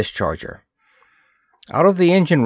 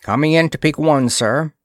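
called report, a bit higher and brisk; quick call to the captain, pitched up; 6 semitones higher, 3 dB louder than usual. A narrator's reading. Navigator reporting to his commander, calling out. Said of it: "he called".

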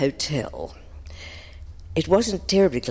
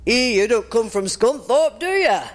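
local bloke; 5 semitones higher than usual. Retold or imitated. Local bloke heckles the singer.

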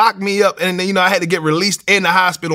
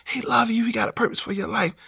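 higher, softer caring nurse; gentle nurse voice; 6 semitones higher, 6 dB quieter than usual. Retold or imitated. Nurse at his hospital bed offering to pray, high and soft. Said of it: "she was like".

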